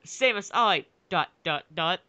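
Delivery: usual speaking voice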